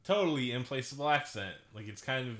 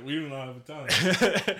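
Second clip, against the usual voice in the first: laughing; 5 semitones higher, 10 dB louder, slower than usual. Real, not acted. Joking about a Canadian shoot.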